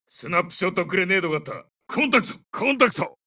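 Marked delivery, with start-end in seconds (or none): 1.9-3.2 high, urgent alert; contact callout. Acted. Soldier alerts squad to enemy contact, urgent high call.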